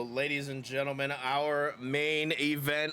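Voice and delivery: ladies and gentlemen announcer voice; ring announcer. Acted. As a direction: introduce the main event grandly. Announcing the main event.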